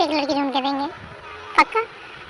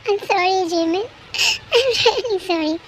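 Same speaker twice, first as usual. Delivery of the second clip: tearful apology; much slower than usual. Acted. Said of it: "तुम रो क्यों रही हो?".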